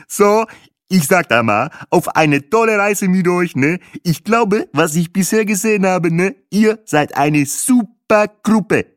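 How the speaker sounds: a guide toasting his tour group; solemn toast. A narrator's reading. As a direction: raise the glass and address the group grandly. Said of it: "hob er feierlich sein Glas".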